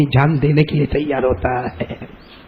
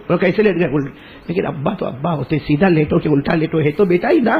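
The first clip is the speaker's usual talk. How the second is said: ordinary talk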